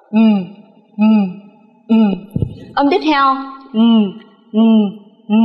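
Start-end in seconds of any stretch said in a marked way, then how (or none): 0.1-1.9 "m" hummed through the nose, lips closed; nasal M. Shown as a demonstration. Teacher showing a nasal sound, "m" hummed with mouth shut. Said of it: "không đọc ra mồm của mình". 3.7-5.3 "n" hummed with tongue raised to the palate; nasal N. Shown as a demonstration. Teacher showing learners the second nasal, "n" hummed through the nose. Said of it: "Đọc giống chữ nừ".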